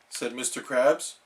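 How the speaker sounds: ordinary delivery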